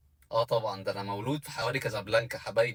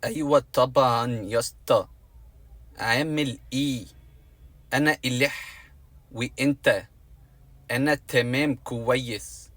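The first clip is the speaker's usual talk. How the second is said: mock Franco Arabic; 4 dB louder, slower than usual. Shown as a demonstration. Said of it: "بتعرف تتكلم فرانكو؟".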